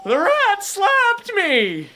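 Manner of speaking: high slow squawking gull; gull complaining. Acted. The gull reports back to the party, high slow squawk. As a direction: wail it like an offended seagull. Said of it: "he goes".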